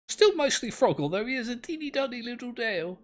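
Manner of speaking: high, quick cutesy voice; high little cutesy voice; odd high voice. Real, not acted. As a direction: squeak it out cutely. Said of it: "I don't know why I said it in that voice".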